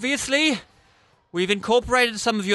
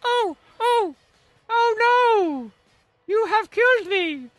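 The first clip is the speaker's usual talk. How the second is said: high, hammy dying cry; puppet voice; 11 semitones higher, slower than usual. Acted. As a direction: squeal it as a dying wife. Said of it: "I'll hold up the puppet".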